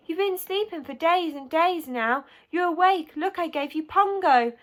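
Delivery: put-on high-pitched, eager girl's chatter; excited child. A narrator's reading. Ellie to waking boy, excited child.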